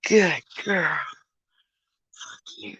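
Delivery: ordinary talk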